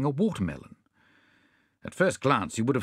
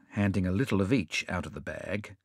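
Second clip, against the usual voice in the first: ordinary delivery, nothing marked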